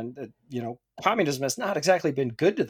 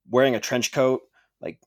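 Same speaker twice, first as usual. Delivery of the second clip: normal talking voice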